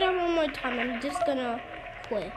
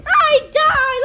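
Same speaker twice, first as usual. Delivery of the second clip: panicked shout; 10 semitones higher, 10 dB louder, much slower than usual. Real, not acted. Character dies in game.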